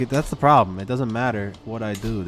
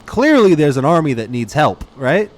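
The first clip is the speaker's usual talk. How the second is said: loud, higher angry rant at useless allies; riled up rant; 8 semitones higher, 10 dB louder than usual. Real, not acted. Player rants at his useless allies, loud and furious. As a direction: really lose your temper at them. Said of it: "I'm getting riled up again".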